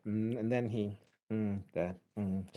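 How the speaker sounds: soft, halting hemming and hawing; annoying hesitant habit. Shown as a demonstration. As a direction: stall on ums and mms, dropping away quietly. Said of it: "Does he have an annoying habit?".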